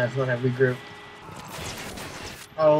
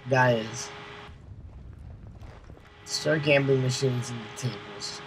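ordinary talk

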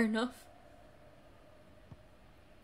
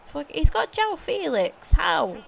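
high, loud outraged surprise; real disbelief; 6 semitones higher, 3 dB louder than usual. Real, not acted. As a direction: blurt it out in shock. Shocked at opponent's player.

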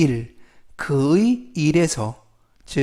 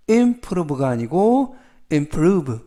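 improve with stress on the second syllable, prove, not im; slow, lean hard on prove in improve; much slower than usual. Shown as a demonstration. Teacher showing where improve takes its stress. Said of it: "강세를 알려드리면".

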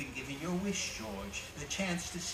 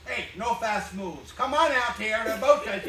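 loud police order; 5 semitones higher, 9 dB louder than usual. Acted. Policeman loudly ordering the intruders out with hands up.

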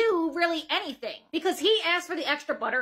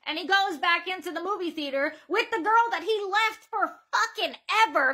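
usual speaking voice

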